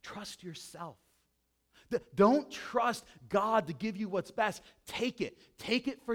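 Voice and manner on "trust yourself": serpent's whisper. Acted. Preacher voicing Satan's lie to the congregation, hushed. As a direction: murmur it as a seductive lie. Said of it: "He says".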